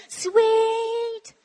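loud, high, long-held "sweet" call; kids calling out. Retold or imitated. Teacher imitating kindergarteners on the bus, calling out high. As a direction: sing it out like a child on a bus. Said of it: "I could hear them going".

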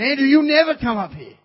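high, loud cry of being taunted; taunting complaint. Retold or imitated. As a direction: wail it to God, building to the mocked words. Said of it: "They're taunting me, saying".

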